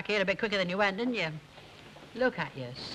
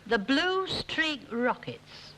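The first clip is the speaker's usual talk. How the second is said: ordinary talk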